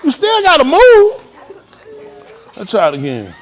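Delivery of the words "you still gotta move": mock exasperation. Real, not acted.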